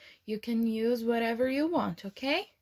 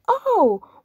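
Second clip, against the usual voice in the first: dismayed oh; 8 semitones higher, 8 dB louder than usual. Acted. Animals caught, bad luck.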